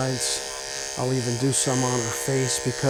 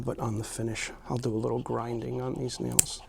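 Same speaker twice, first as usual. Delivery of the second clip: usual speaking voice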